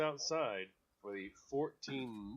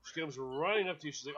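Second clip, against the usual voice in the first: ordinary talk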